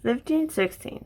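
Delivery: drowsy, half-asleep counting; nodding off. Real, not acted. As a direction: mumble the numbers sleepily. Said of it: "I'm half asleep".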